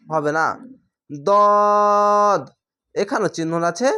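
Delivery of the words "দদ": দদ with a long rounded aw, no aa; chant-like, drawn-out dawd; thick letter. Shown as a demonstration. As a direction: hold a heavy দদ on one note. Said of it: "কোনো আকার উচ্চারণ নেই".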